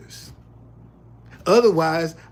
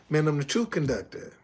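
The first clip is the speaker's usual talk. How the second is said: branded catchphrase; 3 semitones lower, 4 dB quieter than usual. Shown as a demonstration. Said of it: "when I put this hat on".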